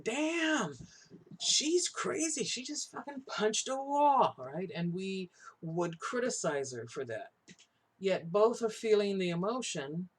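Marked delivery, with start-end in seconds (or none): 0.0-4.3 high, loud mock shock; mocking a horrified onlooker, pitched way up. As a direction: gasp it out like a scandalised bystander. Mock reaction to woman punching wall.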